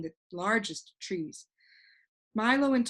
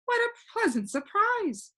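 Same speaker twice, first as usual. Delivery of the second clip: much higher, delighted cry; cried happily; 12 semitones higher than usual. A narrator's reading. The lonely man greets the boys at his door, delighted. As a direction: burst out glad to see visitors. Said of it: "he cried happily".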